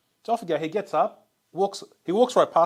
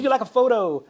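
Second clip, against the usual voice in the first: higher, laughing mock Latin accent; mock Spanish accent, laughing; 11 semitones higher, 7 dB louder than usual. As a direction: ham up a Puerto Rican accent through a laugh. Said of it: "I wanna hear the accent".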